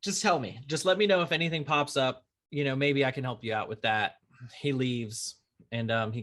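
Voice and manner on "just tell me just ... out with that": higher, quick and eager, retelling his own offer; bright helpful push, reliving the moment; a retold, keen let-me-help voice.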